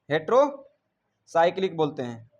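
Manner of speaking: Heterocyclic spelled out slowly, syllable by syllable; a teacher slowly repeating heterocyclic for the class. Shown as a demonstration. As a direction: drag heterocyclic out slowly so students catch each part. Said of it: "अंग्रेजी में इसे कहते हैं बाबू hetero".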